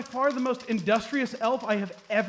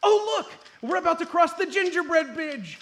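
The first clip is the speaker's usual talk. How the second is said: much higher, eager cry; high excited announcement; 6 semitones higher, 3 dB louder than usual. Acted. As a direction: point it out with glee. Excited spotting the bridge.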